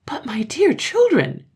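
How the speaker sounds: high, loud swooping surprise; astonished mother. A narrator's reading. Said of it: "said the mother in astonishment".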